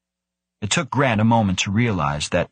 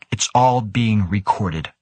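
usual speaking voice

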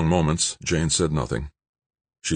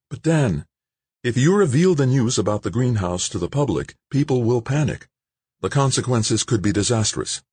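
higher, worried woman's voice; Jane pleading; 8 semitones higher than usual. Acted. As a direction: warn him of panic as Jane.

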